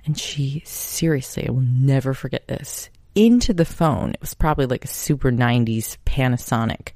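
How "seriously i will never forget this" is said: ordinary delivery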